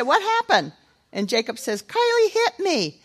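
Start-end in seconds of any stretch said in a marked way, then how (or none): 0.0-0.7 high, motherly questioning voice; niece asking. Retold or imitated. Niece asks the children. 1.8-3.0 put-on high, slow tattling child voice; whiny little boy. Boy complains to aunt.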